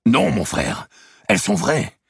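Robert's voice, high and firm; defiant Robert. Acted. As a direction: refuse flatly, loud and proud. Robert denies the forgery.

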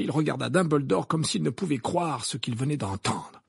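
plain reading voice

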